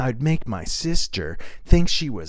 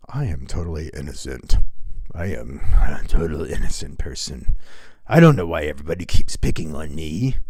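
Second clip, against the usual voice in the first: much lower, put-on whiny innocence; Elon Musk impression; 11 semitones lower, faster than usual. Comic mocking Elon Musk's denials, low whiny put-on voice. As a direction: sulk like a misunderstood billionaire. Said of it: "here's Elon Musk".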